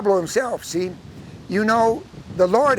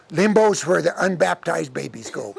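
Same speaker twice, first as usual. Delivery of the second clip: usual speaking voice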